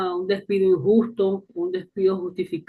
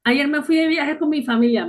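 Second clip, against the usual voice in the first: higher, louder false reply; mock liar; 6 semitones higher, 3 dB louder than usual. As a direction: fib with bright confidence. (respuesta mentirosa exagerada)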